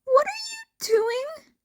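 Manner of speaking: high, crying alarm; panicked, pleading question; alarmed Izuku. A narrator's reading. Izuku alarmed at friend's phone.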